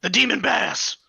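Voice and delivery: loud sudden outburst; shouting. Acted. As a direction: blurt it out loud. Shouting the river demon name.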